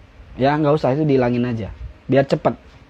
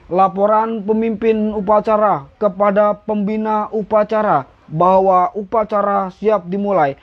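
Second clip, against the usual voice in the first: slow formal report, higher ringing tone; pemimpin upacara; 4 semitones higher, slower than usual. Shown as a demonstration. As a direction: recite it ceremonially, word by word. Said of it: "Kalau aku jadi pemimpinnya". (laporan upacara resmi dan lantang)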